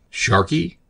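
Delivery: high, rising question; startled cry. A narrator's reading. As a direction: exclaim the name in disbelief, pitch leaping up. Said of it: "cried Craddock".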